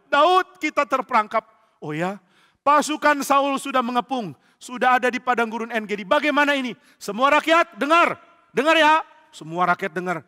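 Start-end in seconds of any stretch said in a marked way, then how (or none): 0.1-9.0 high, loud urgent shouting; acted alarm. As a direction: shout a warning to everyone. Lookout and David raise alarm.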